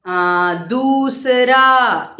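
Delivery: Gita drawn out very slowly, syllable by syllable; slow classroom enunciation; slow teaching demo. Shown as a demonstration. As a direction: spell out Gita slowly for children. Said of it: "बड़ी ऊ की मात्रा के शब्द".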